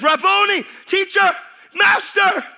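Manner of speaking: high, loud cry of recognition; joyful cry. Acted. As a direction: cry out his name in joy. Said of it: "She says".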